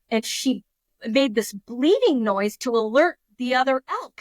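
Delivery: ordinary talk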